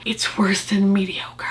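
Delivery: hushed, slow whisper. Acted. Reviewer whispering her verdict on the palette, hushed. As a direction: drop to a slow conspiratorial whisper.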